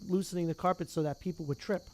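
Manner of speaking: plain reading voice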